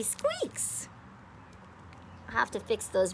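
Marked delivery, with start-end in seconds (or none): 0.0-0.9 high squeaky voice. Acted. Toy squeak demonstrated.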